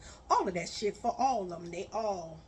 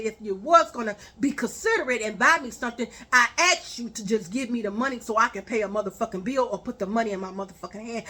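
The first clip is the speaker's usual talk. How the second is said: louder and faster, scolding; angry rant; 7 dB louder, faster than usual. Retold or imitated. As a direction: tear into him, raising your voice. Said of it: "I said".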